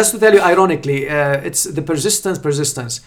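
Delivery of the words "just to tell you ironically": chuckling through the words, a touch fast; laughing. Real, not acted. Surgeon laughing at how his critic came around. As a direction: let a laugh carry the ironic aside.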